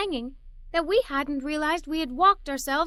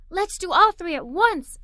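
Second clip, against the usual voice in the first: excited; 4 semitones higher, 6 dB louder than usual. Acted. Child rallies the family.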